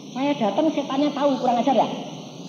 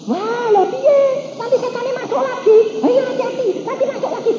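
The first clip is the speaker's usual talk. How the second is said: much higher, hurried worried voice; mock fearful warning; 13 semitones higher than usual. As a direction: scare her with a nervous warning. People warning maid fearfully.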